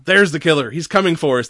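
hammed-up panic about the killer; imitating a man losing it; panicked. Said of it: "Tom starts losing his shit".

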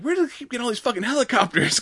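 high, fast incredulous whine; mock fed-up gamer; exasperated disbelief. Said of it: "They're like".

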